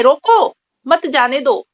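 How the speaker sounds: slow, रोको set apart from मत जाने दो; pause after रोको. Shown as a demonstration. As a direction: stop after रोको, then मत जाने दो together. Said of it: "के बाद विराम चिन्ह लगाया गया है". (रोको के बाद रुककर)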